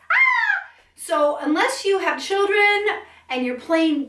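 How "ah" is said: short high squeal of delight; bright high ah. Shown as a demonstration. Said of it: "talk up like this".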